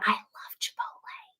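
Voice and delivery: hushed, whispered confession. Real, not acted. Fan confessing her love of Chipotle, whispered. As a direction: drop to a soft whisper.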